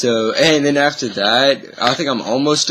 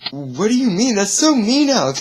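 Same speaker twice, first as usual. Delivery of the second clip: much higher, sulky complaint; mock hurt whine; whiny protest; 8 semitones higher than usual. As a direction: whine at your friend like a hurt kid. Upset at friend's answer.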